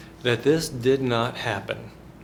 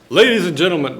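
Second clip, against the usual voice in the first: booming Mississippi courtroom address; Mississippi lawyer accent; 4 semitones higher, 9 dB louder, faster than usual. Acted. Mississippi lawyer opens to the jury in a loud Southern accent. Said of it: "this is what people sound like in Mississippi".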